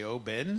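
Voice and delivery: Oban with a full "ben" ending; second syllable of Oban sounded clearly as "ben", not swallowed; mispronounced Oban. Shown as a demonstration. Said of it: "but it's Oban".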